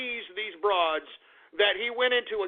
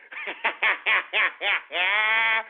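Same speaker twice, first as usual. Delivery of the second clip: long staccato cackle; drawn-out gloating laugh; mocking cackle. Real, not acted. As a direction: laugh derisively in rapid even bursts. Laughs at listeners he just insulted.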